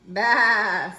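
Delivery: loud, drawn-out bleat; sheep bleat. A narrator's reading. Sheep answering from under the mask, loud long baa. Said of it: "says the sheep".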